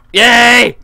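loud, high yell of joy; shouted cheer. Real, not acted. Gamer gets the plane going, loud cheer. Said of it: "my shouty voice".

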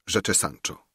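plain reading voice

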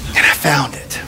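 hushed, relieved whisper. Real, not acted. Pastor confides to the congregation in a whisper. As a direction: breathe it out, barely voiced.

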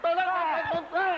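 desperate shouts of go; frantic urging. Acted. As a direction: hurry them on frantically. Urging in a panic.

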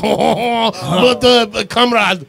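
badly faked French accent slipping into Russian; worst French accent. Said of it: "I did the worst French ever. I was like".

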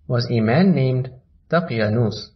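ordinary delivery, nothing marked

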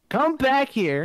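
high call across the room. Real, not acted. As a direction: call someone back from afar. Calling a friend back.

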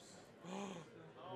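sharp intake of breath; startled gasp. Real, not acted. Commentator reacting to a jinxed shot, startled gasp. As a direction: gasp in sudden disbelief.

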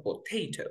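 Potato with ta lifted, soft; stressed T in potato. Shown as a demonstration. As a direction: mark ta in Potato. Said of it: "la estoy diciendo más fuerte".

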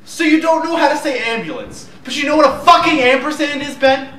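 furious high-pitched shout; freaked out. Acted. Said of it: "you're getting freaked out".

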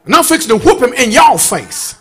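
loud, rising gospel preaching; full-force preaching. Acted. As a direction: preach it hard to the congregation.

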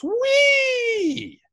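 high, loud mock whee of a spinning can; gleeful whee. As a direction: squeal it out like a delighted toy. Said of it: "the tin can goes".

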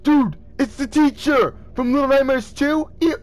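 high, loud burst of surprise; excited recognition. Real, not acted. Gamer spots the teacher from Little Nightmares, excited recognition. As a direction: gasp it out, thrilled to spot someone. Startled recognition of game character.

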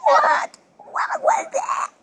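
high, slow, delighted what was that; surprised. Real, not acted. Baby made a sound.

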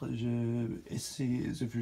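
normal talking voice

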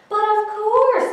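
high, loud, slow "But of course"; the fox pretending innocence; sly fox voice. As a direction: fox protesting he's a duck. Fox claims to be duck.

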